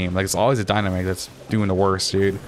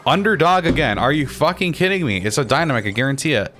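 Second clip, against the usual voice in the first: higher, exasperated outburst; real frustration, pitch leaping up; pissed off; 5 semitones higher than usual. Real, not acted. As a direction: protest in disbelief at bad luck. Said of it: "I'm actually getting pissed off".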